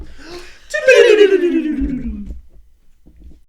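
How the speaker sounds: screaming laughing gasp; loud gasp. Real, not acted. Player gasping loudly as the break starts, shrieking.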